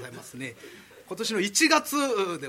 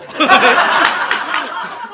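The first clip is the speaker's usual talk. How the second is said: real burst of loud laughter; 7 semitones higher, 12 dB louder than usual. Real, not acted. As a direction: let the laugh explode. Laughs at the excuse.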